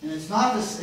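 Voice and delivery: normal talking voice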